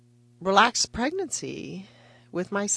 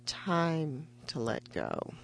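slow, much softer murmur; gentle; 8 dB quieter, much slower than usual. Retold or imitated. Anesthesiologist leaning over the patient, speaking slowly and softly. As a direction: ease her under, soft and slow. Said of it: "leans over me and says".

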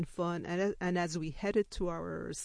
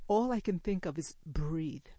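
plain reading voice

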